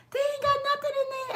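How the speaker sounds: squeaked way up high; shrill high disbelief; high squeaky voice. Retold or imitated. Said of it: "I was like".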